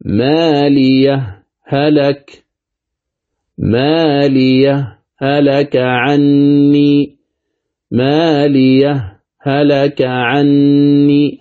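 ما لي هلك recited slowly with a brief stop on the first ha; تلاوة بالسكت; sakt recitation. Shown as a demonstration. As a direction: pause lightly between هلك and the next ha. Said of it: "هذا هو السكت".

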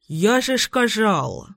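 slow mumble through toothpaste; mouth full. A narrator's reading. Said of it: "бормочет он с пастой во рту".

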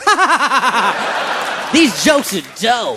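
hammed-up laugh then a slow loud verdict; loud mock laughter, then slow praise; mock self-laugh. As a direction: laugh at your own jokes like a fool. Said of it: "I was listening to it going".

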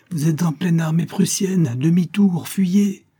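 high-pitched, pressing order to flee; urgent warning. A narrator's reading. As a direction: warn them off urgently, pitch climbing. Innkeeper urgently warns of enemy army.

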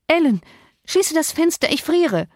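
high-pitched, urgent command; impetuous young woman's cry; vehement call. A narrator's reading. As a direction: call out sharply, pitched well up. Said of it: "Catherine rief heftig".

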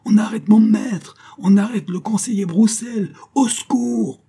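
high, loud cries of alarm; crying out to the whole square; piercing cry. A narrator's reading. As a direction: raise the alarm at a piercing pitch. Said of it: "cria-t-elle".

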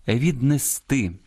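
віднести drawn slowly onto final -ти; stress on last syllable. Shown as a demonstration. Host models proper Ukrainian, slow final-syllable stress on віднести. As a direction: put the weight on the end of віднести. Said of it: "українською правильно віднести".

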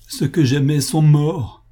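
anguished cry over lost loved ones; crying out through sobs. A narrator's reading. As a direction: wail it through tears. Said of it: "cria-t-elle au milieu de ses sanglots".

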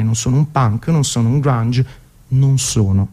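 normal talking voice

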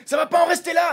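high-pitched threatening mock shout; hammed-up indignant outburst; mock angry shout. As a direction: shout it like an outraged man. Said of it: "et là, le mec fait".

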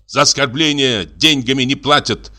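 high-pitched indignant rebuke; angry cry. A narrator's reading. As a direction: throw the insult back at him. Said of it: "крикнул доктор". (негодующий выкрик)

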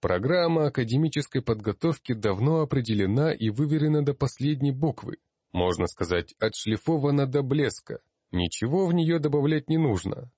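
high, emphatic lecturing; condescending magister, pitched well up; patronizing. A narrator's reading. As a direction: lay down the rules to an upstart. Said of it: "покровительственно произнёс он".

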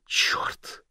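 exhaled under the breath; breathed curse. A narrator's reading. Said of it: "выдохнула Эбби".

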